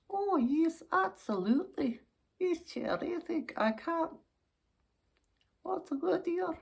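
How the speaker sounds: put-on high-pitched, thrilled old dear; smitten old lady. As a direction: gush like an old lady with a crush. Old lady fond of suitor.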